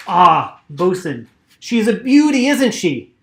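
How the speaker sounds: big in-character exclamation of delight; hearty admiration. Acted. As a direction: admire the boat out loud, proudly. Shipsmith hails bosun, admiring boat.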